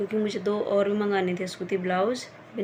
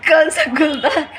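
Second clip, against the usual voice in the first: asking while laughing, louder; amused, loud laughing disbelief; laughing question; 5 semitones higher, 10 dB louder than usual. Retold or imitated. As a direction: giggle through the question.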